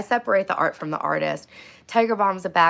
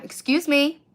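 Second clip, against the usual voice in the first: raised third excuse me; 9 semitones higher, 4 dB louder, slower than usual. Retold or imitated. To the ignoring Walmart worker, a higher pushier excuse me. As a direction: excuse me pitched higher, pushed. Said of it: "Third time".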